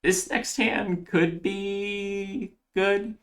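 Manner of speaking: high, stretched-out tentative musing; drawn-out hedging. Real, not acted. Player sizing up the next hand, drawn-out hedging.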